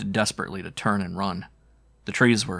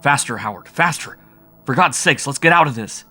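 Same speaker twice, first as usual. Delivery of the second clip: higher, louder panicked shriek; frightened shriek; 6 semitones higher, 9 dB louder than usual. A narrator's reading. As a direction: shriek in terror to your friend. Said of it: "I shrieked aloud".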